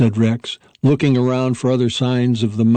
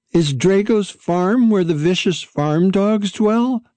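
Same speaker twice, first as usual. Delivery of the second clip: put-on higher, slower young dog voice, nervous under it; a pup putting on a brave face; lightly voiced young dog hiding his fear; young dog, trying for calm; 8 semitones higher than usual. A narrator's reading. Hulicalabuli asks nervously.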